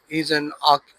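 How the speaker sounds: usual speaking voice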